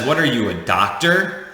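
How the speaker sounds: ordinary talk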